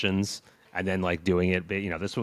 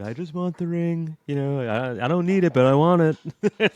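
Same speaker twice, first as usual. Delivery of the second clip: high, drawn-out Trump voice; Trump impression; 9 semitones higher, 7 dB louder than usual. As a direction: do Trump wanting the ring. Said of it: "Trump in Lord of the Rings".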